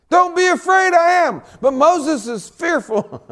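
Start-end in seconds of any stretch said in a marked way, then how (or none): 0.1-1.4 high, very loud proclamation; ringing declaration as Jesus; commanding reassurance. Acted. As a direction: proclaim it at full preaching force. Preacher declaims Jesus' words.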